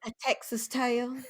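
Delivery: slow, high Texas drawl; hammed-up Southern accent. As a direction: lay on the Southern twang. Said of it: "my best Southern accent".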